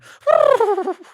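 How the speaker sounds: high squealing fake neigh; whinny like a horse, badly; horse neigh imitation. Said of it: "that's the worst horse impression".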